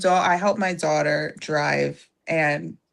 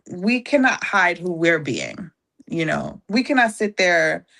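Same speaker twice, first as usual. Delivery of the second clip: ordinary talk, nothing marked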